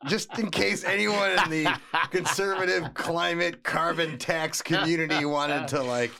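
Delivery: sarcastic. Acted. Sarcastic aside on the headline.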